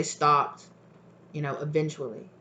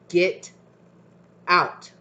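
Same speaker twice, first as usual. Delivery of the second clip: spirit's command; 5 dB louder than usual. Retold or imitated. Spirit voice repeating get out.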